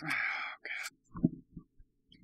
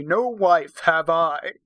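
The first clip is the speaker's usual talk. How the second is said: slow, louder steely denial; steely Viking declaration; 6 dB louder, much slower than usual. A narrator's reading. Viking denying to Ruby he has a wife, steely and slow. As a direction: declare it like an offended warrior. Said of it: "he declared in a steely voice".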